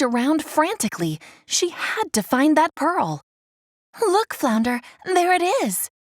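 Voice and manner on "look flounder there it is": higher, eager call to her friend; excited call. A narrator's reading. Ariel calling to Flounder on spotting the pearl, excited. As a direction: point it out with excitement. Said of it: "Ariel shouted".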